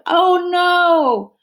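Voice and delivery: high, loud dismayed cry. Acted. Girl finds her trellises blown down, loud dismayed cry. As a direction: wail it in sudden dismay like a kid. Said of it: "Shaquila crying out".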